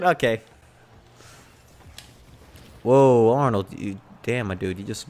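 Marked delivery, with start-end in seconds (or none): none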